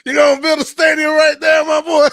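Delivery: loud, high mocking yell through laughter; high incredulous shout. Real, not acted. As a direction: yell it in disbelief at the site. Mocking a stadium site, laughing.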